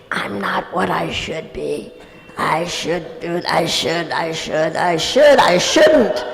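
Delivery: low self-reproach building to emphatic; self-shaming. Acted. Inner critic nagging oneself with should, self-shaming and building. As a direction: start under your breath, mount with each should.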